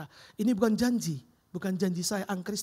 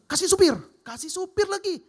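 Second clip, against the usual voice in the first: high, loud eager command; boss ordering; 10 semitones higher, 8 dB louder than usual. Retold or imitated. Boss orders a driver for the employee, excited. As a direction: call it out like a generous boss.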